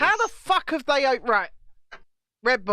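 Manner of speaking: high loud disbelieving outburst; loud high outrage; outraged. Real, not acted. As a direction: explode in disbelief. Outraged at Red Bull.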